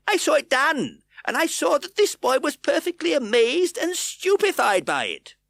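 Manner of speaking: very high, loud eager account; urgent testimony. A narrator's reading.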